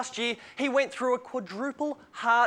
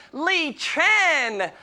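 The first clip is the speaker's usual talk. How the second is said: ordinary talk, nothing marked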